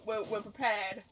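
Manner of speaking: ordinary delivery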